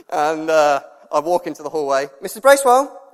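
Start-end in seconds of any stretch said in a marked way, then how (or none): 2.2-3.1 high, loud mock phone greeting; calling out. Dad winds up daughter by pretending to phone her teacher, loud teasing call.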